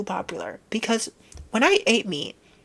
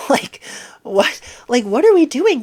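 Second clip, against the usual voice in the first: higher, louder exasperation; loud exasperated question to internet people; 7 semitones higher, 9 dB louder than usual. Real, not acted. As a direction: throw up your hands. Exasperated outburst.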